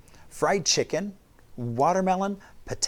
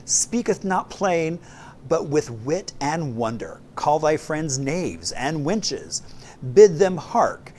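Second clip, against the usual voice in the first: grand, slow, booming proclamation; loud mock Shakespearean; 4 semitones higher, 4 dB louder than usual. Host declaring Talk Like Shakespeare Day, loud mock-Shakespearean proclamation. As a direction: declaim it like a hammy town crier of Shakespeare's day. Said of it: "they talk loud".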